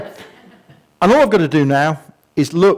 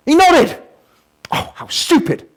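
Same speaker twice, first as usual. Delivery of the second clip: indignant outburst; 10 semitones higher than usual. Real, not acted. Exasperated outburst at retake.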